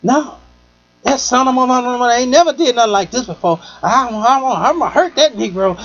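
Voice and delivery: high, threatening rant; a hint of a furious friend's voice; imitating angry friend. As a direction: vow revenge like a furious woman. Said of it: "she was like".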